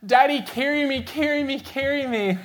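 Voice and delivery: put-on high little-boy voice pleading to be carried; toddler begging. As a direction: whine it like a tired two-year-old. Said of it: "and he goes".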